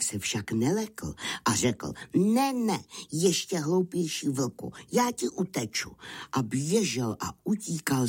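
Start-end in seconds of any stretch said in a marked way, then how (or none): 2.2-5.9 high-pitched, cocky lamb voice; cheeky little lamb. Acted. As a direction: taunt as a small lamb in a high voice. Narrator plays the cheeky lamb. (drzé beránčí pískle vysokým hláskem)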